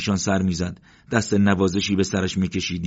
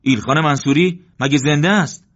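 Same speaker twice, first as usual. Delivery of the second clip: loud, much higher astonished question; surprised; 8 semitones higher, 7 dB louder than usual. A narrator's reading. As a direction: call out in amazement. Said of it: "با تعجب گفت".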